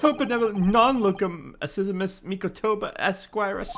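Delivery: usual speaking voice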